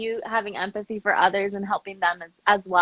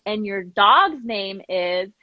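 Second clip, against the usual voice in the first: slow prompting; 3 semitones lower, much slower than usual. Retold or imitated. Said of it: "And I was like".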